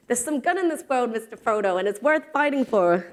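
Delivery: badly faked English country accent, lifting the voice for Sam's line; terrible Samwise accent. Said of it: "That was a terrible accent".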